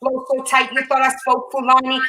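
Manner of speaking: rhythmic spoken word, punching each I'm so Brooklyn; spoken word poem. Acted. Poet closing the show for the host, rhythmic recital. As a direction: perform the poem with a steady beat. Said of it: "close us out with some dope poetry".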